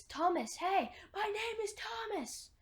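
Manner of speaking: high-pitched mock yell of sudden discovery; imitated shout. Newcomer suddenly remembers his name, imitated high shout. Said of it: "and he shouts".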